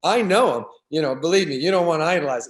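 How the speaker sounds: high, loud mock insistence; emphatic dissuading. Hometown buddy warning fan off idolizing, emphatic. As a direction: imitate the buddy insisting he knows better.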